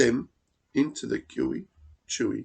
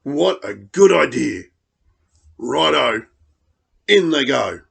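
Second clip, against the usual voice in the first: cheery dingo; 7 dB louder than usual. Acted. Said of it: "Dingo laughed".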